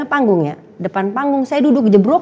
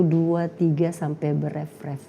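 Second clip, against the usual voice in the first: ordinary talk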